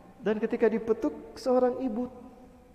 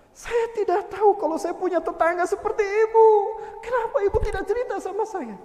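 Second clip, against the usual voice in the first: higher, crying plea; tearful shoemaker; 9 semitones higher, 4 dB louder than usual. Acted. Shoemaker apologises to widow, higher tearful voice. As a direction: choke up with remorse.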